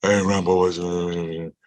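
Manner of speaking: lightly mimicked big-man mumble; a hint of a famous athlete's lazy greeting; Shaq. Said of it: "You know, that voice".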